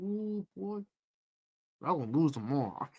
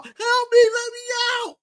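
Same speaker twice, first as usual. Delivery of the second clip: mock pleading; 13 semitones higher than usual. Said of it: "He let them all".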